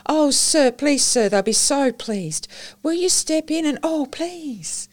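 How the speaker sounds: put-on high, eager child's voice, breathless with delight; a small girl thrilled to see her visitor; excited little girl. Excited child greets Lord Peter.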